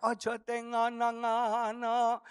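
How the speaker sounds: slow, drawn-out Javanese lilt; Javanese accent. Acted. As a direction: stretch it out like a patient Javanese speaker. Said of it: "Kalau orang Jawa kan ngomongnya". (logat Jawa yang pelan mendayu)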